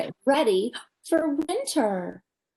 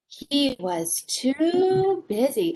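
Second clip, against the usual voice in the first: slow, leaning on too; slow refrain; 2 semitones higher, much slower than usual. Acted. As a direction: stretch the refrain out. Storybook refrain, drawn out.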